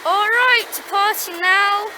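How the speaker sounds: loud, high, excited announcer; excited shouting. Pretend. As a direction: shout the departure with glee.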